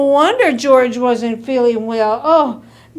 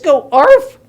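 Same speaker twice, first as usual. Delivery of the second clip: urging bark demonstration; 2 semitones higher, 9 dB louder than usual. Acted. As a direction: show the dog how to bark, loudly. Mother pleads for a bark.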